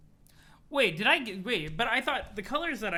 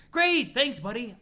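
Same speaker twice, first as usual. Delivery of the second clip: much higher and louder burst of cheer; spring it out like a fake-friendly ally; overeager cheer; 6 semitones higher, 8 dB louder, slower than usual. Thanking a partner for a present, overeager cheer.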